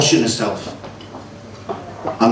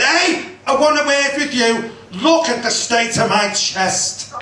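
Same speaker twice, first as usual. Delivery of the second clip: indignant wife; 8 semitones higher than usual. Retold or imitated. Wife confronting specialist.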